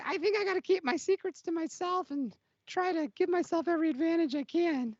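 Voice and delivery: bright high voice of an eager little racer; high-pitched racer voice. Acted. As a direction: play a jittery alien pod racer, high and quick. Racer character voice.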